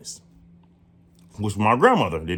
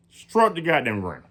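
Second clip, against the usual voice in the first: usual speaking voice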